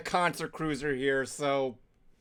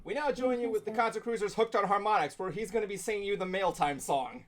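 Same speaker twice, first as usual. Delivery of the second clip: smooth announcer voice, softer; radio announcer; 3 semitones higher, 5 dB quieter than usual. Acted. Host announcing the mail song, smooth radio voice. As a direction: introduce the segment like a DJ.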